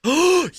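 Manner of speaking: sharp high gasp of delight; excited gasp. Real, not acted. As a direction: gasp in thrilled surprise. Cad Bane appears on screen.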